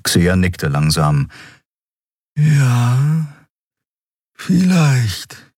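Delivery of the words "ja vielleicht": higher, hesitant agreement; Xea's voice. A narrator's reading. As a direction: agree high and unsure. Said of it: "Xea doubtful".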